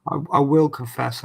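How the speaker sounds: normal talking voice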